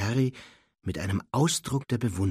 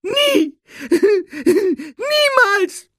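piercing, loud elf wail; tearful wailing; 12 dB louder, much slower than usual. A narrator's reading. Elf overcome by Harry's kindness, loud sobbing wail. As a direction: cry it out very high, sobbing between words. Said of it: "jammerte er".

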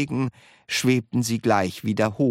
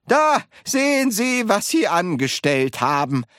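high, loud scolding at the start; reproachful; 10 semitones higher, 4 dB louder than usual. A narrator's reading. Wonka blaming grandma for the mishap, reproachful. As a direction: scold with an exclaimed first word.

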